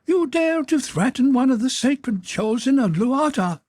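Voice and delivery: outraged, piercingly high monster voice; screaming creature. A narrator's reading. Creature rebukes the man threatening it, screaming in outrage. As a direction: screech it as a scandalised holy creature. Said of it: "it screamed".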